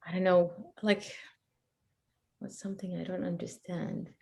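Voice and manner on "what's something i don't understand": ordinary delivery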